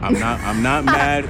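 slow mock insistence he isn't mad; mock defensive. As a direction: protest too much, slowly. Mock defensive about [name].

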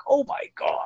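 high, airy, hushed amazement; starstruck gasp. Retold or imitated. Dealer face to face with his idol, starstruck. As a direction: squeak it softly in disbelief.

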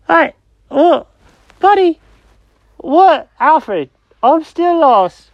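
high-pitched confused cat; squeaky cartoon cat, lost and puzzled. Jenkins the cat voice.

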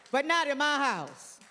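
ordinary talk

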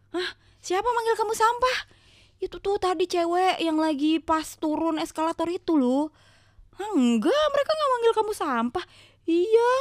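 high, loud disbelief; shocked. Retold or imitated. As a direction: gasp it out, disbelieving. Shocked retold quarrel.

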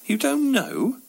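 high, astonished question; can't believe what you just heard; incredulous. Acted. Narrator stunned by the answer.

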